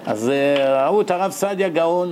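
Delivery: normal talking voice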